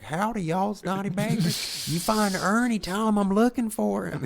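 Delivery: high-pitched, twangy character voice; Donnie Baker. Retold or imitated. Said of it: "Donnie Baker even went on, like, Facebook Live and was like".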